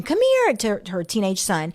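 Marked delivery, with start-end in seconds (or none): 0.0-0.5 high-pitched calling yell; retold yell. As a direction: shout for the son across the house. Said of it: "starts yelling and saying".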